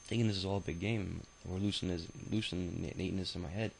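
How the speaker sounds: usual speaking voice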